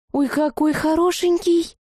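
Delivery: put-on high, drawn-out girlish gushing; excited cooing. A narrator's reading. Blonde girl gushing over a newcomer, excited cooing. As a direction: coo over someone adorable. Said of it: "заинтересованно загалдели".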